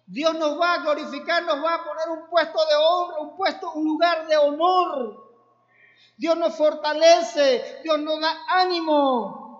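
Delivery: loud exhortation, drawing out the promises; fervent revival preacher calling out God's promises; preaching at full force. Acted. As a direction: preach it with fire to lift the brothers. Preaching to the congregation.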